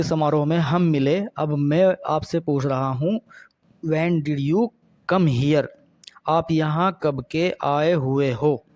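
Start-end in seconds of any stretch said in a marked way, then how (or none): none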